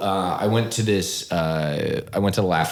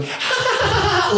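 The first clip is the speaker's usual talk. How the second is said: much higher forced ha-ha laugh; a shrill performative giggle to show she's in on it; mock high fake laughter. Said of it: "the girl next to her is going".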